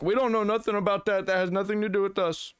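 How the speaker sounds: put-on high, quick Indian accent; put-on Indian accent, pitched well up and hurried. Imagined Valve support staff denying blame, Indian accent. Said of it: "I used an Indian accent there".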